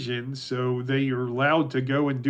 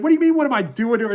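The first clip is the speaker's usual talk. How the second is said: fast, high, louder mock outrage; indignant retort; 8 semitones higher, 5 dB louder, much faster than usual. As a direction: fire back as the exasperated wife, imitated. Retold wife snapping back at husband.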